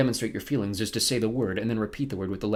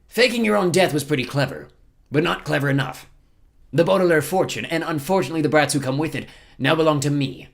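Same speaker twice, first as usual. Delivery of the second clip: higher, louder villain voice; gloating villain captain; 6 semitones higher, 6 dB louder than usual. Acted. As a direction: gloat over a beaten victim, loud.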